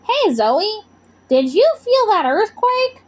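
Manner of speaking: high, calm, friendly monster voice; a gentle monster checking in. Acted. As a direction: Gorp asking the girl about the quake. Monster Gorp voiced in story.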